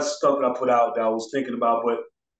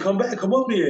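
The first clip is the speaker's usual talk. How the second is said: calling her back; 7 semitones higher than usual. Retold or imitated. Calling her onto stage.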